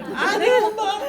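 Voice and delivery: high, loud mock protest; panicked child. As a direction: cry out pleading to mother. Child panics at police threat.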